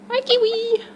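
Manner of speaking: very high, loud squealing hello; excited squeal. Real, not acted. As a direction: greet the bird with pure delight. Excited greeting to the bird.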